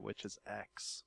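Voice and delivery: a soft, slow secret; secretive hush. Pretend. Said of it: "so be quiet so I can tell you the secret".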